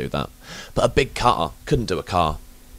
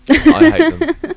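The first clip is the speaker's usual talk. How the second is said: very high, drawn-out loud declaration; comic outburst; 6 dB louder, much slower than usual. Real, not acted. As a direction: stretch it into a high, hammed-up cry. Ranting about audio commentaries.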